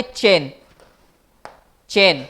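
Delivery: चैन said slowly and clearly, with a crisp ch at the start; demonstrating chain. Shown as a demonstration. Teacher shows students how to say चैन, crisply enunciated. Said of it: "हमें चैन बनाना है तो यहाँ पर तीन नुक्ते लगाएंगे".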